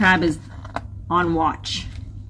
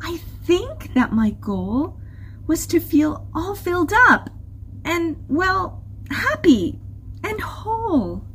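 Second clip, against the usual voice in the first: higher, hesitant, tender voice; fighting back tears; 11 semitones higher than usual. A narrator's reading. Humphrey confesses his goal to Crystal, holding back tears. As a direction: grope for the words with little pauses. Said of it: "he fought back some tears".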